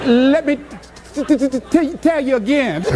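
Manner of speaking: stammered t-, t-, t-, t- with laughter breaking in; friend's stutter retold with laughter; imitated stutter. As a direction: stutter through it while cracking up. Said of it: "she stutters".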